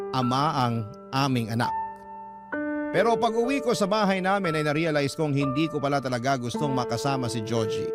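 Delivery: ordinary talk, nothing marked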